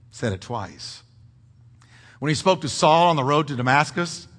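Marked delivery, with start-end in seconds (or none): none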